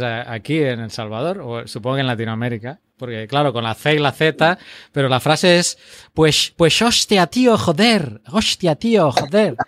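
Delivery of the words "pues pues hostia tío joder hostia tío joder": imitating a Spaniard as Latin Americans do, slow and emphatic; hammed-up peninsular Spanish swearing, Spain accent; mock Castilian Spanish accent. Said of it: "Eso es un español".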